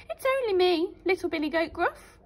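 a touch of fright, small goat voice; trembling goat. Acted. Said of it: "trembled the goat".

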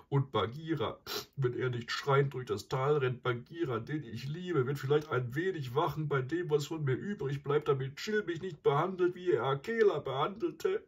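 high, self-pitying lament; plaintive, sorry-for-yourself voice. A narrator's reading. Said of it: "klagte er weiter".

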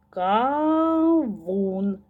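"кавун" slowly as long "ка", then "вун"; slow chanted "кавун"; syllable by syllable. Shown as a demonstration. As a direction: stretch "кавун" into two beats. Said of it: "промовляйте слова разом зі мною".

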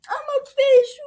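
high, loud, joyful shout; loud excited squeal. Acted. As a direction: cheer it out. Happy fish character.

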